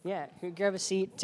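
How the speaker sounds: normal talking voice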